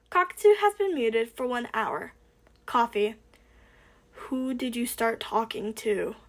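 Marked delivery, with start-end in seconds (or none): none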